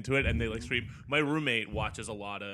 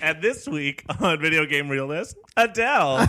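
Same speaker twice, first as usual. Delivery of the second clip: raised, louder show intro, peaking on the name; mock announcer; 4 semitones higher, 6 dB louder than usual. Introducing [name] on a pretend show, mock announcer. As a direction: announce the guest with joking fanfare.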